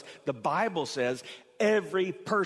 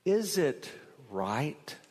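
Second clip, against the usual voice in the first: slow searching question; much slower than usual. Acted. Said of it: "It could have been".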